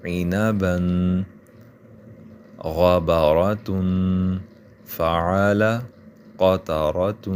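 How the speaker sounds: each word read out slowly and clearly for learners; word reading drill. Shown as a demonstration. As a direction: read single words like a Quran teacher. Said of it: "اب". (الگ الگ لفظ صاف صاف پڑھنا)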